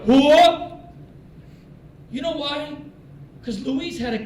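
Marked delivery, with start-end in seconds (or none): 0.1-0.8 loud military battle-cry response; short, loud, gung-ho holler; motivated shout. Retold or imitated. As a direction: bellow it like a fired-up airman. Airman's motivated catchphrase, acted out.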